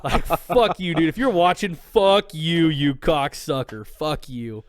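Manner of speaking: angry shout. Real, not acted. Cursing the old man who might be watching, shouting. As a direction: yell it like he's listening right now.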